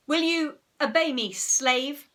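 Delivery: high, loud imperious question; grand hypnotist's demand; masterful command. A narrator's reading. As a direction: lord it over your slave. Henry masters his subject.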